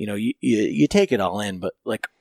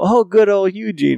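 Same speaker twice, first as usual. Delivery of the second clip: much higher, louder fake recognition; mock familiarity; 10 semitones higher, 7 dB louder than usual. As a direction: pretend you know him well. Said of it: "And I go".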